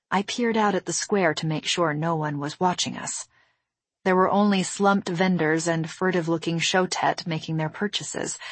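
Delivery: ordinary delivery, nothing marked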